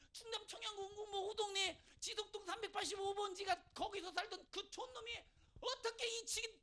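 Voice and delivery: ordinary talk, nothing marked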